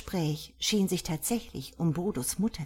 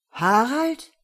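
higher, louder questioning echo; puzzled surprise; 6 semitones higher, 8 dB louder than usual. A narrator's reading. Gerda hearing an unknown name, puzzled.

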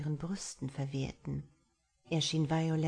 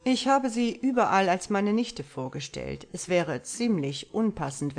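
a lightly voiced man, firm and louder; a hint of a composed gentleman laying out his case; Marlowe's voice; 6 dB louder than usual. A narrator's reading. Marlowe explains his plan to Violet, firm and louder.

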